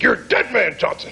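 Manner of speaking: mock threat. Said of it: "It's like".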